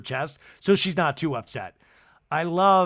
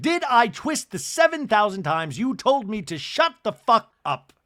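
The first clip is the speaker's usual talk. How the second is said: angry Bronwyn; 8 semitones higher, 7 dB louder than usual. Retold or imitated. Bronwyn yelling at Angie.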